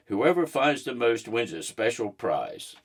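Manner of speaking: ordinary delivery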